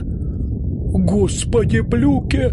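drawn-out, high, astonished cry; stunned professor exclaiming; sudden exclamation. Acted. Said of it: "воскликнул вдруг профессор".

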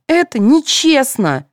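slow, high whine; high pouting whine; sulky protest. A narrator's reading. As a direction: drag out the complaint. Girls complain about gifts.

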